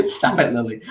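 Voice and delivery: laughing while holding the dog. Real, not acted. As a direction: laugh and scold the pet. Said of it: "Are you wrestling a dog right now?".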